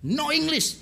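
high-pitched, curt refusal, imitated; old Chinese driver. Old man from China refusing English, imitated. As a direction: mimic an old man's high, stubborn refusal. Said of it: "Orang tua dari Tiongkok itu".

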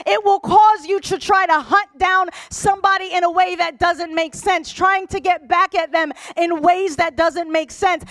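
high, loud sermon delivery; impassioned preaching. Real, not acted. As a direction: drive the point home to the congregation. Preaches at full force.

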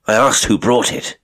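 sharp insistence. A narrator's reading. Colonel pressing his evasive cook, sharp. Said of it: "he insisted sharply".

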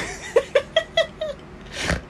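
high, breathless creepy cackle; Chucky-like laugh. Real, not acted. Laughing at a friend while watching, creepy doll cackle. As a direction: let out a shrill horror-doll giggle. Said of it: "I sound like Chucky a little taste".

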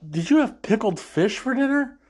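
high, mock-disgusted question; quoting Sokka, pulling away from the kiss; acting Sokka. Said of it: "he's like".

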